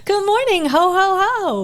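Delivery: high, loud singsong Santa call; festive ho-ho-ho greeting. Pretend. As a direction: belt out a mock Santa hello, way up high. Festive holiday-season opening, Santa-style greeting.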